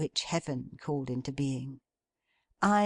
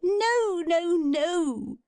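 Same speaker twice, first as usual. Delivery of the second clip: much higher, louder delighted no-no-no; delighted cry; 14 semitones higher, 4 dB louder, much slower than usual. A narrator's reading. Meg delights at her father's wrong guess. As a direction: squeal with joy at his wrong guess. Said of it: "cried Meg, delighted".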